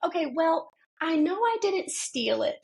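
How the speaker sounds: a hint of a stubborn child.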